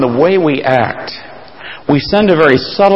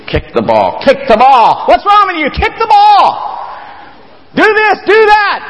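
parents yelling. Retold or imitated. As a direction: shout at the kid like a frantic parent. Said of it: "they're cheering like crazy".

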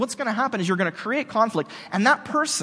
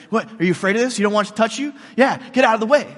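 mocking dare, growing louder at the end; mock taunt; 3 semitones higher, 5 dB louder, faster than usual. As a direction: taunt him with the bacon.

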